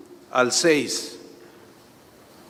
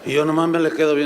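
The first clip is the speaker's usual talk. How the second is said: ordinary talk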